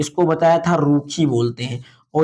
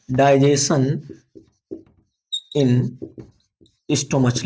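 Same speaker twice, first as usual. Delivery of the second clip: English heading, word by word; slow dictation; 3 semitones lower, slower than usual. Shown as a demonstration. Teacher giving pupils time to write, slow dictation. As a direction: leave a long pause after each word. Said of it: "लिख लेंगे".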